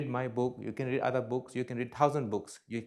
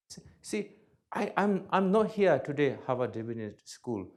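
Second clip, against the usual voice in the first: usual speaking voice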